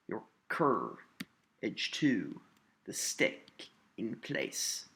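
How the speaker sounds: "Your cur, edge to" in pairs, CUR and TO punched; slow feet with pauses, stressing CUR, TO, STICK, PLACE; separated iambs. Shown as a demonstration. Teacher splits the line into iambs, each second syllable stressed. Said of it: "an unaccented syllable followed by an accented syllable".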